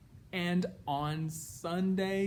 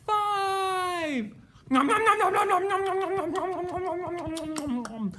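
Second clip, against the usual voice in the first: high, loud nom-nom chant; broad singsong kids theatre; 13 semitones higher, 7 dB louder than usual. Acted. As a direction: chomp it out gleefully. Pea eating candy.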